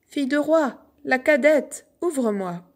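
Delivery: appel plus haut et plus fort derrière la porte. A narrator's reading. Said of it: "on appela". (la grenouille appelle la princesse derrière la porte, forte et aiguë)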